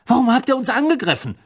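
fast, high-pitched protest; outraged, rushed question; indignant question. A narrator's reading. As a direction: demand an explanation heatedly. Ron protests being attacked.

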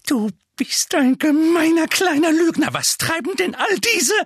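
high, loud, angry outburst; indignant high-pitched tirade; scolding aunt. A narrator's reading. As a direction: scold the little liar. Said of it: "dann schimpfte Tante Petunia".

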